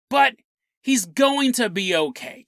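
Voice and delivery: normal talking voice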